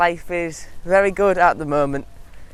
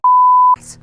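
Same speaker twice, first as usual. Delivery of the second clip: laugh; 9 dB louder than usual. Real, not acted. Laughs at own speech.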